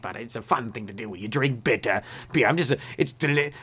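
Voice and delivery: rambling mock-English accent; weird English guy. Weird Englishman explaining his odd routine, hammed-up English accent. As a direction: ham up an eccentric Englishman's accent. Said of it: "that one weird English guy".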